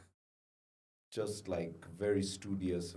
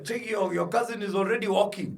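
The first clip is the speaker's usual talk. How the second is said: mocking relative; 7 semitones higher, 5 dB louder than usual. Family comparing him to cousin.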